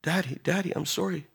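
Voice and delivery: soft, tearful-style apology of a daughter; acted contrition. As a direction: plead quietly with a father. Runaway daughter begs forgiveness.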